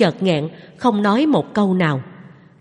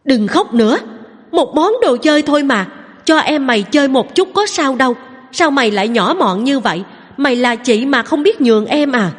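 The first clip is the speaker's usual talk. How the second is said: scolding mother, higher and louder; angry scolding; 6 semitones higher, 3 dB louder than usual. A narrator's reading. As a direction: snap at the crying daughter. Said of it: "không kìm được cơn giận mà quát". (mẹ quát con gái đang khóc)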